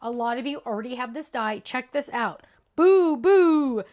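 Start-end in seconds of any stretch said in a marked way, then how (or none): 2.8-3.8 sudden loud playful boo; startling boo. Pretend. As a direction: jump out with a spooky boo. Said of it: "Did I scare you?".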